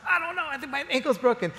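high, quick mock panic; panicked hurt kid. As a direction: retell it as a rattled injured boy. Hurt boy retold, panicked.

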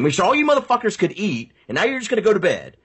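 high whiny rant of a brat; bratty tantrum. Retold or imitated. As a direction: throw a little temper tantrum at them. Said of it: "temper tantrum".

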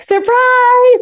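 high, drawn-out gleeful "surprise"; surprise shout. Retold or imitated. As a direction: imitate an excited surprise reveal. Retold excited greeting on walking in.

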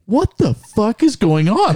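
high, drawn-out incredulous outburst; dumbfounded disbelief. Retold or imitated. Boyfriend watching crowds line up for his girlfriend, dumbfounded. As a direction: stretch it out high, utterly baffled.

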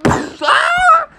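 very high, acted scream; crash scream. Dad crashed into a tree, screaming. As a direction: yell as if hitting a tree.

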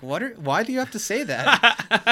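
high, exasperated Chidi. Retold or imitated. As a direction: throw up your hands. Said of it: "Chidi's like".

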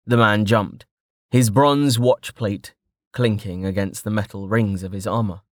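plain reading voice

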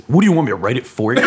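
fast, laughing imitation of Will; mock exasperation. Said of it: "he would say".

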